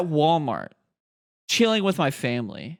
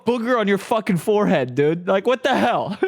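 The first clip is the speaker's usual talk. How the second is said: higher, louder laughing mock-dad disbelief; dad's disbelief; 5 semitones higher, 4 dB louder than usual. Dad at Walmart asks about the booger, half-laughing disbelief. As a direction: play the dad amused and baffled. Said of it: "He's like".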